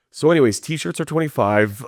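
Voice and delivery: pivot breezily into the price list; merch pitch. Retold or imitated. Performer to a fan ending the conversation, breezy sales pitch.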